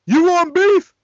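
high, loud hyped-up taunt; Master P's beef line. Retold or imitated. Retelling the famous line, hyped-up taunting yell. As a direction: bark the challenge up high and loud. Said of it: "don't forget the infamous line".

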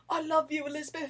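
put-on botched Scottish accent with a Cockney ending, breaking into laughter; Scottish turning Cockney. Fan quoting Desmond's love line, Scottish-to-Cockney accent. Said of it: "I added on a Cockney ending to that Scottish accent".

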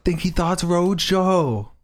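slow show-title announcement; announcer voice. Pretend. Savours the title.

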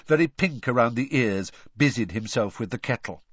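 plain reading voice

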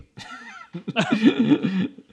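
loud burst of laughter. Real, not acted. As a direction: crack up loudly. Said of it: "big laugh".

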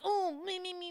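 high whiny tantrum voice; childish tantrum. Retold or imitated. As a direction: mimic a bratty child. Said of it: "when they just snap and they're like".

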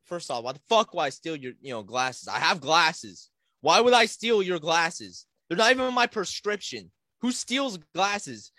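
loud, high, exasperated self-defence; indignant protest. Retold or imitated. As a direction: argue back in acted outrage. Acted indignant self-defence to teacher.

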